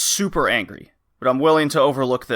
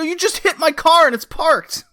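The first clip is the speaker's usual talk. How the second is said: much higher, strained re-enacted outrage; outraged protest; 3 dB louder than usual. Arguing with the driver who hit his parked car, outraged. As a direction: protest in high-pitched, acted indignation. Re-enacted parking lot argument.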